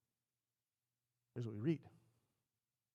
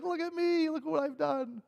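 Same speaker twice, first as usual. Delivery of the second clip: high-pitched, gleeful boasting; happy Saul; 13 semitones higher, 4 dB louder than usual. Acted. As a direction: show off proudly in a high voice. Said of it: "And he's all happy".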